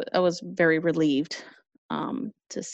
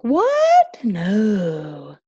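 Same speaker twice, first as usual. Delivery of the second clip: [name]; 2 semitones higher, 6 dB louder than usual. Retold or imitated. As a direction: mimic him, shooting up to a loud squeak. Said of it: "To paraphrase [name]".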